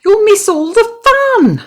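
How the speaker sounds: very high, loud urgent plea; high pleading. A narrator's reading. Frog pleading with Toad not to miss the fun.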